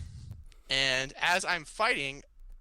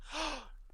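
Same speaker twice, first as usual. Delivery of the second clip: gasp; 13 semitones higher, 11 dB quieter than usual. Retold or imitated. The moment it hit him.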